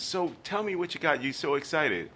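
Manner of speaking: high mother's voice; mom asking. Retold or imitated. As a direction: voice the mom, indulgent. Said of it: "She says".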